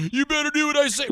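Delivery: squeaky mock threat; mock bully. Mimicking Rod strutting around the set, mock threatening.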